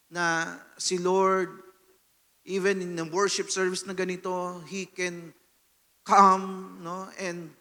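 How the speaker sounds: ordinary talk, nothing marked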